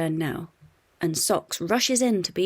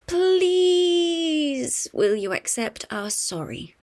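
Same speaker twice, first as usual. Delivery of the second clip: pleading; 10 semitones higher, 5 dB louder, much slower than usual. A narrator's reading. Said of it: "asks Dad".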